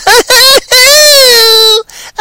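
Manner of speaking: long, very high, loud cackle; funny-voice laugh. Pretend. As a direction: squeal out a drawn-out doll laugh. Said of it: "with a funny voice".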